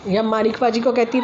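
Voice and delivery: ordinary delivery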